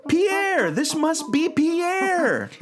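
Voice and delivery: high, thrilled cry of recognition; eureka, stretched out; excited realization. Acted. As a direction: suddenly get it and exclaim it high. Realizes the monkey's identity.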